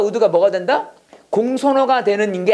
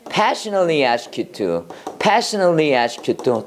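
lower, slow, repeated for practice; slow model phrase; 4 semitones lower, much slower than usual. Shown as a demonstration. Teacher drilling the phrase with students, slow. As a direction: say it slowly twice to copy. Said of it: "열정적으로 기도합니다".

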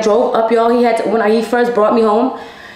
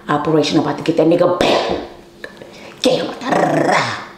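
scheming whisper. Retold or imitated. Plotting to sneak out.

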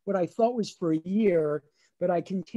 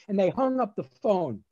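ordinary delivery

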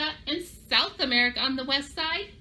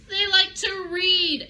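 playful reveal; 9 semitones higher, 4 dB louder, slower than usual. Acted.

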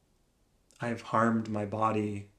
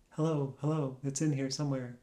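softer, slightly raised, tiny voice; small voice; 2 semitones higher, 5 dB quieter, faster than usual. Acted. Speaker searching inside for their own voice, small voice. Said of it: "My voice, I feel, is so small".

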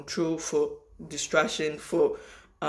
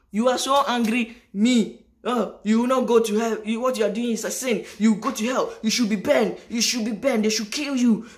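mocking a hateful commenter, raised pitch, hammed-up; hammed-up zealot condemning her; mock angry critic; 4 semitones higher, 4 dB louder than usual. Vlogger imitating an angry commenter, mock outrage.

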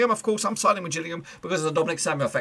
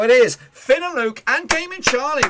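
excited announcement; 9 semitones higher, 6 dB louder than usual. Real, not acted. Announcing the week's winners.

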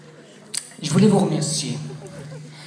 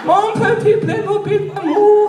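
Angela's voice; 12 semitones higher than usual. Pretend. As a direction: coo like an adoring Angela on the phone. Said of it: "Oui, allô, Angela".

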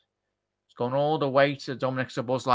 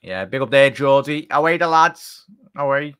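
louder cheery shout-out; 2 semitones higher, 7 dB louder than usual. Real, not acted. Streamer giving Geordie viewer a louder cheery shout-out.

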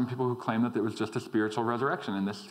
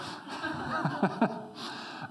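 light laugh; 10 semitones higher, 3 dB quieter than usual. Real, not acted. Laughs at own joke.

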